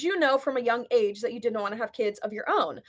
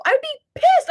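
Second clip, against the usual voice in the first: indignant outburst; 7 dB louder than usual. Real, not acted. Outraged aside.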